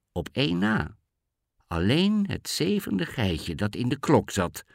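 ordinary delivery

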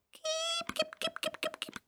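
tiny, very high, soft chicken call; high little voice. A narrator's reading. Boy bending down to lure chickens, high little voice. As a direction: squeak out a small high hen call. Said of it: "riep met een hoog stemmetje".

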